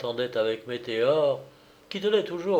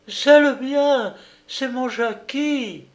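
very high, slow, loud mother's cry; a mother finding her stolen baby; joyful cry; 12 semitones higher, 6 dB louder, slower than usual. Acted. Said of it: "elle s'écria". (cri de joie maternel, très aigu et lent)

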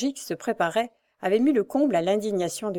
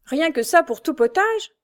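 higher, louder scornful cry; indignant exclamation; 8 semitones higher, 4 dB louder than usual. A narrator's reading. Jacques mocking his brother's meagre earnings, indignant cry. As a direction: exclaim in disgust at the money. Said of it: "s'écria Jacques".

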